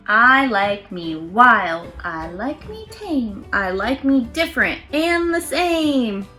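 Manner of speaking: ordinary delivery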